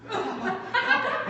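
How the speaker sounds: put-on high, slow, drawn-out child's call; a little girl showing off to her dad; child calling papa. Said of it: "You'd think she was like".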